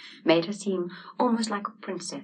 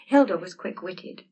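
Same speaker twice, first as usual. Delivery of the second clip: plain reading voice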